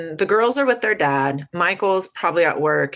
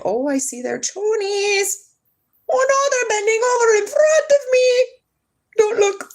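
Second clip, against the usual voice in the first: mock squeal; 3 dB louder than usual. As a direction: squeal in pretend alarm, way up high. Mock alarm at seeing someone's underwear.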